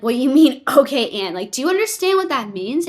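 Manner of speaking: high, loud "okay, and?" at her calm husband; wife loudly questioning husband's calm reaction to the diagnosis; incredulous. Retold or imitated. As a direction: push back loudly, almost laughing in disbelief. Retold disbelief at husband's calm.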